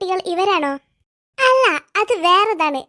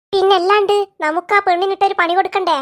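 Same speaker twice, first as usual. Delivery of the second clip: normal talking voice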